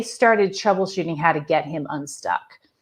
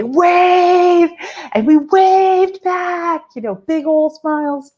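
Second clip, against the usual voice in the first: delighted drawn-out wave; 12 semitones higher, 5 dB louder, much slower than usual. Retold or imitated. Girls wave from passing car.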